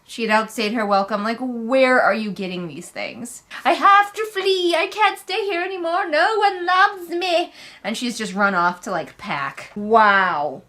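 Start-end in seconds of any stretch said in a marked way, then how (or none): 3.6-7.5 much higher, hammed-up wailing sobs; over-the-top fake crying princess voice; mock princess crying. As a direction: whine it like a melodramatic princess running off in tears. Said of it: "crying full princess mode".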